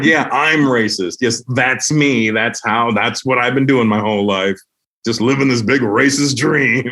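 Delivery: slow, drawn-out mock admission; sarcastic confession. Comic answering online accusers, sarcastic. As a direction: stretch it out with heavy sarcasm.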